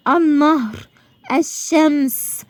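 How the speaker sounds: النهر and الشمس slowly, L silent, doubled n and sh; silent lam. Shown as a demonstration. Teacher showing words where lam is silent, slow assimilated article. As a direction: stretch the n of النهر and sh of الشمس, dropping the L. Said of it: "ما نطقتش أنا اللام خالص".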